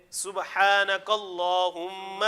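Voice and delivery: very slow, drawn-out Arabic supplication; measured teaching chant, phrase by phrase; repeat-after-me recitation. Shown as a demonstration. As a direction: stretch each phrase for students to repeat. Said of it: "کفارہ مجلس کی دعا پڑھتے ہیں".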